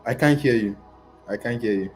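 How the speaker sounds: ordinary talk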